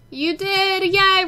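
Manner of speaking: high, loud little cheer for the homework; excited cheer. Real, not acted. Cheering homework done in game.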